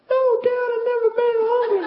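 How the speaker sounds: extremely high, fast squeal; comic falsetto outburst; tiny cowed son. Retold or imitated. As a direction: squeak it out loudly like a shrunken child. Shrunken son answers dad.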